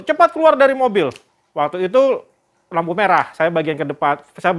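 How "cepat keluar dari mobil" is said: raised, urgent mock order; higher-pitched retold warning call; retold urgent command. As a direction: act out ordering the family out. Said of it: "saya beri aba-aba".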